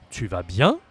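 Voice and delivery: "bien" swept upward as a question; loud teacher's model, pitch climbing on "bien"; rising question. Shown as a demonstration. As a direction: lift the end on "bien". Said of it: "And raise your voice".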